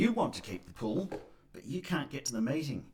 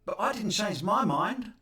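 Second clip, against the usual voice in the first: higher, pointed objection; indignant counter; 8 semitones higher, 3 dB louder than usual. Retold or imitated. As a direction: push back, mock indignant. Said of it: "So you counter with".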